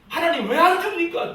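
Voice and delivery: high, loud mock protest to God; complaining prayer. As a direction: imitate an indignant, demanding prayer. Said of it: "항상 하나님 앞에 원망 불평하고". (원망하며 따지는 기도 흉내)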